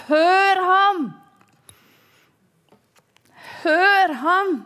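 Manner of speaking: high, loud call; calling from the cloud. Retold or imitated. The Father calling from the cloud, loud and high. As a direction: proclaim it from above. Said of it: "Faderen ropte fra skyen".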